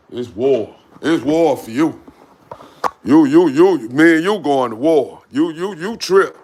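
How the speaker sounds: loud, playful threat with the dog; mock war declaration. Owner threatening the dog who took his hat, loud mock war cry. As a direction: bellow it like a hammed-up battle cry.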